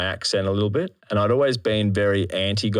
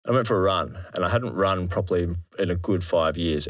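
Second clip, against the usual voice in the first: ordinary talk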